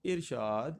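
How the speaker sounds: ارشاد as "ir-shaad", i first; pronunciation option. Shown as a demonstration. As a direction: say ارشاد with "ir" and a rising end. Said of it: "اس کا کیا بنے گا".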